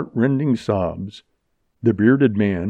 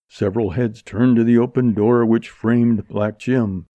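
plain reading voice

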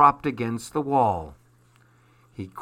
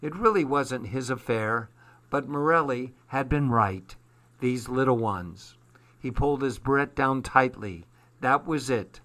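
ordinary delivery

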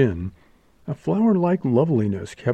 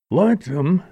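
snorted; 7 semitones higher than usual. A narrator's reading. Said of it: "snorted her companion".

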